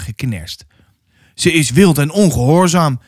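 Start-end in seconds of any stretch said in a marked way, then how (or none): none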